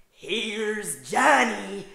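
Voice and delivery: very slow loud menacing drawl; Jack Torrance. Pretend. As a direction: savour it like a madman. Shining line quoted.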